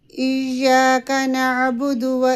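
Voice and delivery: slow, drawn-out tajweed recitation; measured Quranic chant. Shown as a demonstration. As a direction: chant each word carefully. Recites verse as model.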